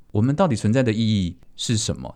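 normal talking voice

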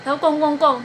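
ordinary delivery

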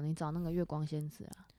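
teasing whisper. Real, not acted. Friend teasing about Sailor Moon in a whisper. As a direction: whisper it as a sly aside.

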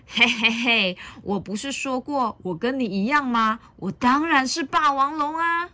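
villainous storybook boast, lifting in pitch; hammed-up dinosaur glee; gloating Tyrannosaurus. A narrator's reading. Villain's reveal, line repeated.

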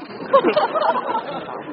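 squealing high laugh tumbling down; high falsetto laugh. Acted. Caught fake ghost bursts out in a high giggle.

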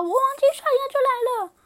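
high loud duckling voice; little duck. Acted. Duckling making an excuse to leave, high voice. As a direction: quack it out like a small duck.

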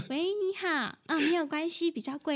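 put-on high, cutesy baby voice on the phone. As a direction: coo it like a little doll. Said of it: "如果我哪天改成娃娃音".

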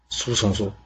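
usual speaking voice

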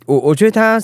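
ordinary delivery

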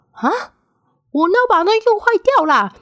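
high, put-on voice of outraged disbelief; indignant protest. Student denies breaking the machine, high mock indignant protest. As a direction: act out the indignation of being wrongly blamed.

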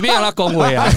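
normal talking voice

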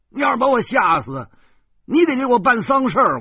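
high furious shout at a prankster; angry yelling. A narrator's reading. Narrator cursing Zhou Pangzi after being scared, furious shout. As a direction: bellow it in a rage, voice cracking high. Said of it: "我张着嘴就骂".

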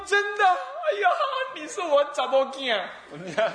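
put-on high, astonished cry of an old woman, slow at the end with a laugh; old woman's high-pitched amazement breaking into a laugh. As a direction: play the stunned old mother who can't believe it. Old woman surprised by stranger.

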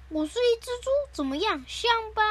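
high, comic show-off voice; playful boast. Acted. Controlled Gian declares himself a pig, comic boast.

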